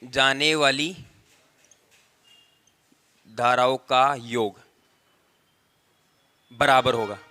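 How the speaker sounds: slow word-by-word dictation, long pauses. Real, not acted. Teacher dictating the definition to students, slow measured dictation. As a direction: dictate it piece by piece for note-taking.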